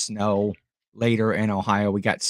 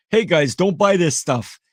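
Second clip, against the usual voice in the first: higher-pitched mock Russian accent; Roman's Russian accent; 4 semitones higher, 3 dB louder than usual. Reseller imitating a friend warning viewers off bad buys, mock Russian accent. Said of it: "that's my, my Roman Russian".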